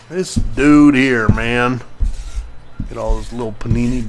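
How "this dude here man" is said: ordinary delivery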